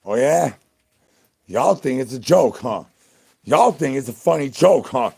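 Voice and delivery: loud hammed-up indignant yelling at chat; mock angry rant. Streamer yelling at chat for laughing, hammed-up fury. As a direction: blow up at the chat over their joke. Mock outrage at chat's joke.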